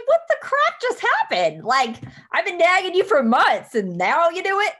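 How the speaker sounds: high, loud, hammed-up disbelief; mock astonishment. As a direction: squeal it in exaggerated, exasperated amazement. Acts out her astonished reaction.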